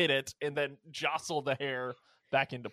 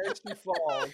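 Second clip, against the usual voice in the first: piercing high scream with a laugh; blood-curdling shriek; 14 semitones higher than usual. Pretend. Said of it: "a blood-curdling shriek".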